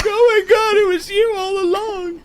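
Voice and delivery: very high, loud squeal; hammed-up victim's terrified cry; mock horrified realization. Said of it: "and I'm like".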